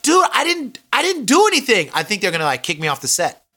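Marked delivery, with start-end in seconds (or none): 0.0-1.9 high, anxious protest; extra pleading with the producer, high and anxious; pleading innocence. Retold or imitated. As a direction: insist you did nothing, pitch up. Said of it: "And so I'm like".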